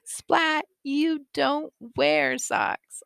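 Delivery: slow, high-pitched mock mom; mom's exasperated reply. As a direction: draw it out like a mom correcting her kid. Mom answering the tantrum.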